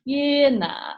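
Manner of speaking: "Yeah, nah." acted as a casual kiwi reply, falling. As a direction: drop "Yeah, nah." like a laid-back New Zealander. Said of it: "And you'll go".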